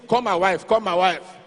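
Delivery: loud urgent plea, retold; acting out a panicked demand; urgent order. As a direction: call it out like an emergency. Urgent orders to his doctor.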